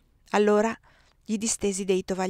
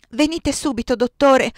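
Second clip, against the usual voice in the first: loud, higher urgent call for the doctor; 5 semitones higher, 8 dB louder than usual. Acted. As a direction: shout for the doctor from the door. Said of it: "udii chiamare dalla mia voce". (chiamata urgente a gran voce, più acuta)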